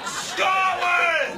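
high, long, falling shout; defiant battle cry. Acted. Trapped Scot rallying against lift, battle cry. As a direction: roar it like a war chant.

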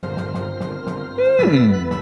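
ordinary talk, nothing marked

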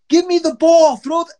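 high, loud demand; imitated screaming. Teammate demanding the game ball from the dugout, imitated yelling. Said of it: "he's screaming".

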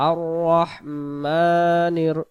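আর রহমানির slowly chanted with a held "maa"; one-alif stretch. Shown as a demonstration. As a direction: roll the r, then stretch the middle of রহমানির. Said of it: "এক আলিফ টান দেব".

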